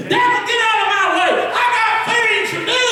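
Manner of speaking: sudden high shout, fast and defiant; shouting at the devil. Acted. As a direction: bark it at the devil. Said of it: "say, "God, I got things to do".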